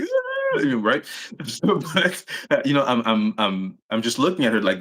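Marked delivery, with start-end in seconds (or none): 0.0-0.6 high, drawn-out imitation wail; mock bawling wail. Showing how he bawled at the officer, mock wail. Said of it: "I said it like".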